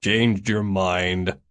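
slow, disgusted grumble; slow exasperated groan. A narrator's reading. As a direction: drag it out in exasperation. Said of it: "Mavis groaned".

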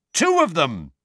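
high, loud, emphatic; heated insistence. A narrator's reading. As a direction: drive the point home. Boy insists in heated argument.

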